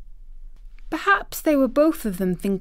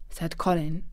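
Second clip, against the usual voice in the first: usual speaking voice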